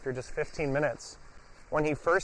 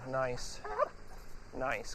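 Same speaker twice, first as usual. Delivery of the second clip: hushed soothing praise; soft calm praise; 8 dB quieter than usual. Real, not acted. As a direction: settle the dog with a soft word. Calming the dog down.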